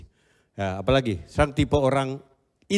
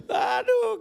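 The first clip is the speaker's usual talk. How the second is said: laugh. Real, not acted. Laughing at himself.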